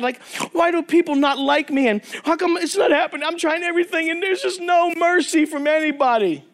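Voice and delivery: high-pitched, mock-tearful lament; imitating a crying girl. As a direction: plead as if close to tears, acted. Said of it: "One of the girls starts crying".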